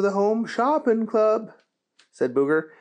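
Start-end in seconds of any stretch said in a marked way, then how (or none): none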